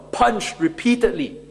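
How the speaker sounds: usual speaking voice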